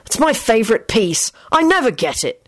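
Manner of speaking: high, sulky grumble; mumbled complaint. A narrator's reading. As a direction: grumble over losing a favourite. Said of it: "he mumbled".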